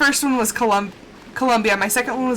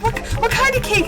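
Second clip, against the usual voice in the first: eager question; 11 semitones higher than usual. Retold or imitated. Eager question about the cake.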